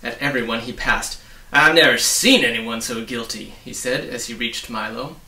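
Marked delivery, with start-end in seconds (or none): 1.5-3.5 booming, accusing policeman; stern officer accusing the boy loudly; loud accusation. Acted. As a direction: bark it down at the boy.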